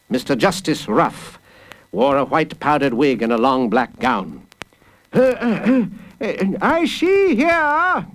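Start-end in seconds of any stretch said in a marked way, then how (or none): 5.1-8.0 high, slow judge's growl. A narrator's reading. Judge opening the case against a child, growling. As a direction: growl it high, clearing the way into the charge. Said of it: "he growled".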